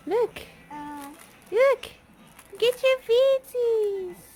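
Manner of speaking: high, stretched-out cooing. Real, not acted. Coaxing baby to grab feet.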